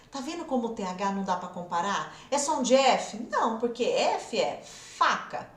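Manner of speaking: ordinary talk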